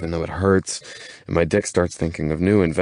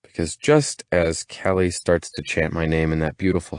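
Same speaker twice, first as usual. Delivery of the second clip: usual speaking voice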